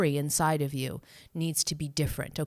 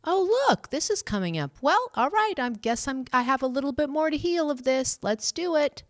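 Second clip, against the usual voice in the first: jumps much higher on "Oh, look" and "Well, all right"; upbeat, accepting self-talk; 9 semitones higher than usual. Retold or imitated. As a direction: cheerfully shrug it off and get to work. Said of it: "the story should be".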